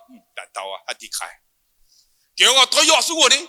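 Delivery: ordinary talk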